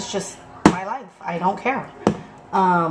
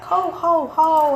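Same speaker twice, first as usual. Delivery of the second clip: Santa ho ho ho; 9 semitones higher, much slower than usual. Retold or imitated. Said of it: "He's like".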